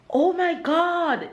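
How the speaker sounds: high, slow excited oh my God; excited exclamation. Shown as a demonstration. Vlogger showing how to say it in excitement, high and slow. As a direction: gasp it out in delight, slowly. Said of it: "in excitement".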